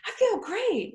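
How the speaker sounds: high, enthusiastic imitation; elated client. Client answering the photographer's "how do you feel", high elated chirp.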